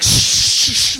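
loud, repeated shushes; acted shushing. Crowd hushing the blind beggar, shushing. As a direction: hush him sharply. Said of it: "the people who are just like".

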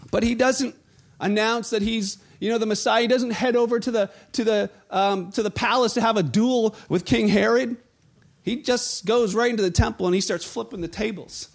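ordinary talk

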